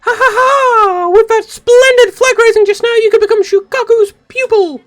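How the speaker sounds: a shrill cartoon boast; high squeaky anime girl. Praising the flag raising, very high squeaky girl voice. High-pitched character laugh and line.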